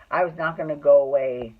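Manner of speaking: ordinary talk, nothing marked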